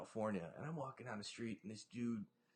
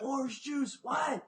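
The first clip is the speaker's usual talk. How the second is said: high, loud joking yell; playful shouted demand; mock shout; 11 semitones higher, 7 dB louder, much slower than usual. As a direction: bark it out in mock outrage.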